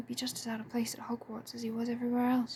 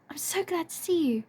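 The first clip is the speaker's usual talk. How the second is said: delighted; 7 semitones higher, 4 dB louder than usual. A narrator's reading. Lily hugging her friend.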